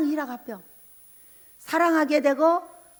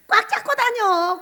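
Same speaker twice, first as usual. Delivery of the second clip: mock frightened plea; 7 semitones higher, 5 dB louder, slower than usual. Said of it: "무서우니까".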